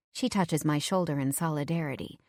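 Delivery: ordinary delivery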